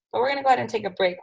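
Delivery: normal talking voice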